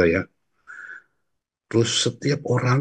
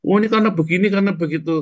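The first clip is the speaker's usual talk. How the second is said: lightly imitated official, pitched higher and louder; mock tax officer; 10 semitones higher, 4 dB louder, much faster than usual. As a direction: parody the explaining tax man. Tax officer explains, mocked. (meniru petugas pajak dengan nada tinggi)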